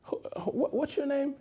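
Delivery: high, light stranger asking a name; lightly voiced curious stranger. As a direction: pitch it way up like a surprised stranger. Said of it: "the person that didn't know me said".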